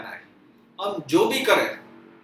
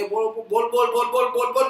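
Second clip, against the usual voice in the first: grumbling on and on; 6 semitones higher than usual. Retold or imitated. Husband grumbling while eating.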